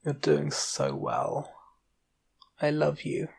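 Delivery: soft, loving reassurance; tender reassurance. A narrator's reading. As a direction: reassure them softly, full of gratitude and love. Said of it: "I reassure her".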